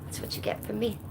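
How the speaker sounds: whispered aside. Real, not acted. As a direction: breathe it out unvoiced. After twirl.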